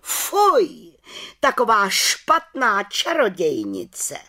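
high, loud, slow indignation; outraged exclamation. A narrator's reading. Head witch denounces the little witch, exclaiming in outrage. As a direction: cry out scandalized, drawing out the words. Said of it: "pohoršeně vykřikla".

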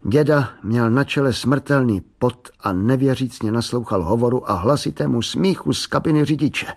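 usual speaking voice

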